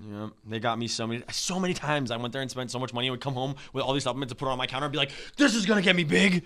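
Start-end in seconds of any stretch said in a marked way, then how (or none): 5.4-6.5 higher, louder naive gym-bro hype; mock gym-bro hype. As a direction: sell yourself the dream. Mocking his younger gym self.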